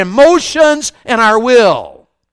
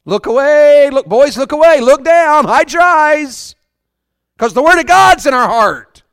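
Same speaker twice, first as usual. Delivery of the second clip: raised, higher warning call; urgent warning; 3 semitones higher, 4 dB louder than usual. Acted. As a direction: call out to the boys, stretching the last words. Urgently warning boys to look away.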